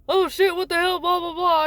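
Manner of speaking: high-pitched retold alarm. Son finding his sister fallen, mock alarmed. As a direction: imitate a startled onlooker, then wave it away. Son reacts to seeing the fall.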